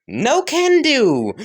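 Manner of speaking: high, drawn-out singsong refusal; cheeky refusal. Acted. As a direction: toss it back high and slow. Cheeky refusal in acted exchange.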